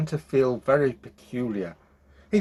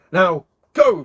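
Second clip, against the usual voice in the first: louder, higher send-off; firm command; 5 semitones higher, 9 dB louder than usual. A narrator's reading. Witch sending her son off, firm command. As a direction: order sharply, with force.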